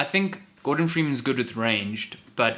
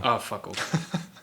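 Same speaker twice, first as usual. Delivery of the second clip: exasperated groan; 3 dB quieter, much slower than usual. Real, not acted. Groaning at a pun.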